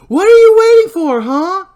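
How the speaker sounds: high, very loud taunting shriek; screaming taunt at the unseen killer; furious scream. Acted. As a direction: scream the challenge into the night. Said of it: "as she repeats".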